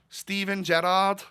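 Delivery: high, slow attempt at Scouse; Liverpool accent. Shown as a demonstration. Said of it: "That's my, uh, Liverpool accent".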